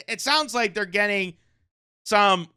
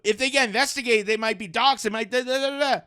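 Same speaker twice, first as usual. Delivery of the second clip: mock panic; 6 semitones higher than usual. Mocking online critics of the probe, high mock panic. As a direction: parody a hysterical critic, voice up high. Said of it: "everyone's jumping on the".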